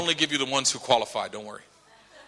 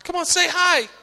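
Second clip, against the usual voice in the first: higher, coaxing parent voice; parent prodding child; 5 dB louder than usual. Acted. Parent urging shy daughter to greet someone, coaxing. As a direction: nudge a bashful child to speak.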